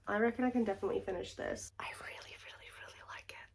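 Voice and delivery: soft, fast whisper. Real, not acted. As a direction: whisper it like a secret. Whispered confession.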